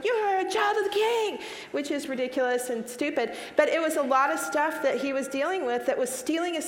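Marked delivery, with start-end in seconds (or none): 0.0-1.4 retold yell out the window. Mother calling out the car window to her son, mock yell. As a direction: call it out the window to her kid. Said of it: "I have embarrassingly yelled that".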